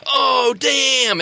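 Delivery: high, loud startled gamer cry; shouted reaction at the screen; troll boys' outburst. Acted. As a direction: blurt it out like a rowdy troll lad losing a game. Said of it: "look at her and go".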